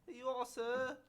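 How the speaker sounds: hammed-up British politeness; bad British accent. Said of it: "That was a terrible accent".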